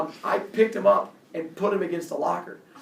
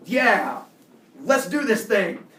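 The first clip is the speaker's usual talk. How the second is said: loud, higher, slower fake confidence; mock bravado; 6 semitones higher, 8 dB louder, much slower than usual. Kid answering the bully's challenge, with mock bravado. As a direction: pretend you are ready to fight. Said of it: "And I was like".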